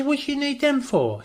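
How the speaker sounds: mock disbelief. Asking his girlfriend why she needs grenades, mock disbelief. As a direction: retell it wide-eyed. Retold incredulous question.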